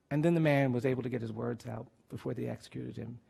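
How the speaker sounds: ordinary delivery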